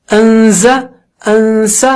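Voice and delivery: انز، انس with the n held in the nose; انز، انس stretched on a level note; nasal ghunna drill. Shown as a demonstration. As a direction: hum evenly before releasing z and s in انز، انس. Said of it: "اسی طرح".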